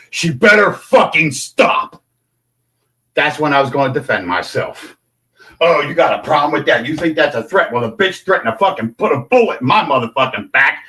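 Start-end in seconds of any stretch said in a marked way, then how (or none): none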